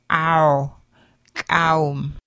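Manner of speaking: "kaum" with a nasal ow back in the throat. Shown as a demonstration. Teacher modelling rounded pharyngeal for learners, nasal ow. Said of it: "a nasally ow back in the throat".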